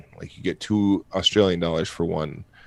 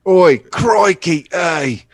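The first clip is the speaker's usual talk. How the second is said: hammed-up Aussie "Oi, crikey", high, loud and slow; mock Australian accent; 8 semitones higher, 6 dB louder, much slower than usual. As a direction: play a cartoon Australian bloke, stretched and up high. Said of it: "That's what he said, just like that".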